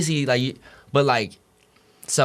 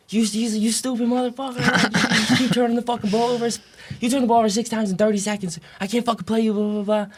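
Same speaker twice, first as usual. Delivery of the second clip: much higher, sharp mock coach tirade; imitating a furious coach chewing out a freshman; retold coach yelling; 11 semitones higher than usual. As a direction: bark it out like the angry coach. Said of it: "I'm getting yelled at".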